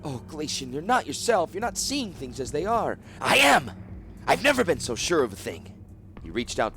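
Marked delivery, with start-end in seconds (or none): 0.0-3.0 higher, anxious plea; pleading wife. Acted. Wife begs her feverish husband to see clearly. 3.2-5.8 loud, high protest; insistent cry. Acted. Sick husband protests loudly to his worried wife.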